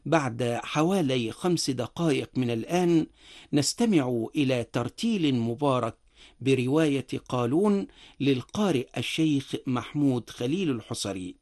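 ordinary talk

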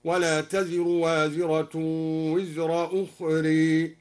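slow recitation ending أخرى in a long ee-like e, ukhree; imala on أخرى. Shown as a demonstration. Teacher demonstrates imala, أخرى ending in long e. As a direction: bend the last a of أخرى toward e. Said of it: "وأمال قوله تعالى".

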